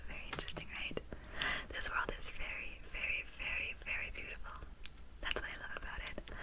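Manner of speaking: hushed ASMR whisper. Acted. Tutor musing to the student on language, whispered. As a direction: whisper it close and gently. Soft-spoken lesson drops into whisper.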